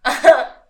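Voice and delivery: loud real laugh bursts out; burst of laughter. Real, not acted. Co-host laughing at her own crude punchline. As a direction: crack up at the joke.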